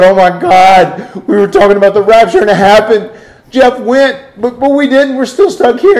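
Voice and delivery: loud, high-pitched mock wailing; hammed-up, high, distraught crying; crying in panic. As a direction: act out a tearful panic at full volume. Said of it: "He started crying".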